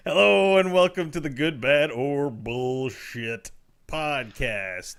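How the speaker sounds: big, loud, drawn-out podcast intro; show-opening announcement. Real, not acted. Host opening the podcast after a countdown, loud announcer delivery. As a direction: belt out the show's welcome, stretching the title.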